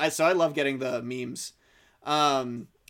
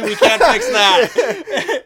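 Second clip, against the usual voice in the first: slow words through laughter; laughing; 11 semitones higher, 11 dB louder, much slower than usual. Real, not acted. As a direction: laugh it out slowly. Apology breaks into laughter.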